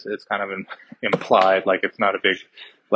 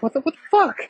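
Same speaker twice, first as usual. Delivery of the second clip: imitating disbelief; much faster than usual. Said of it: "Doctor Fate's like".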